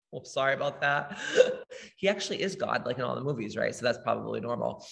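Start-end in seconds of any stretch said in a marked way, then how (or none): none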